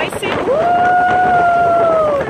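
long rising startled "uh"; startled by gust. Real, not acted. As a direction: caught off guard by wind. Strong gust hits mid-sentence. (surpresa com a rajada)

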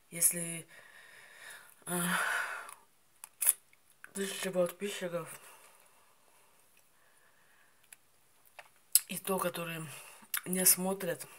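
pausing to bite fruit; talking while chewing. Real, not acted. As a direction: mouth partly full of berries. Seen 2: bites currants off stem at mouth, chews while talking.

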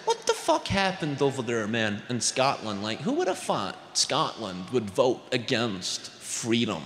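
lightly voiced American asking in disbelief; mild American accent; American guy. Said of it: "And the guy goes".

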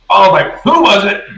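high-pitched acted interrogation; demanding. Sun questioning the assembled crew, demanding.

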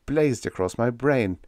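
plain reading voice

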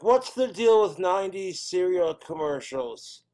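ordinary talk

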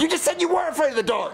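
high-pitched frustrated protest; exasperated shout. Acted. Dad hearing child fears the dark, exasperated shout. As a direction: throw the child's words back.

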